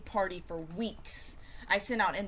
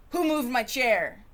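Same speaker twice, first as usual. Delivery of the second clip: snappy; 4 semitones higher, 6 dB louder than usual. Acted.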